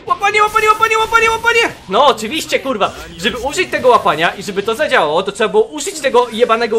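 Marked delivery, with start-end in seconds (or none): 0.1-1.6 high-pitched repeated chant; frantic urging. Real, not acted. Frantically urges the grab move.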